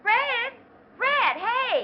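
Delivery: calling out loudly and high across the sand. Acted. Lita spots Fred on the beach and calls him over, calling out loud. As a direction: hail him from next door, bright and loud.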